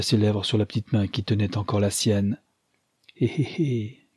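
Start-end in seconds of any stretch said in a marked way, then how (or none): 3.2-4.1 put-on a mischievous little old-lady laugh; sly chuckle. A narrator's reading. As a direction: chuckle knowingly.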